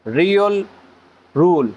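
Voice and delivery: each word set apart by a pause; teacher says the rule name slowly; slow spelled-out name. Shown as a demonstration. Teacher naming the rule for students, word by word. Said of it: "हमारा आज का lecture रियल रूल".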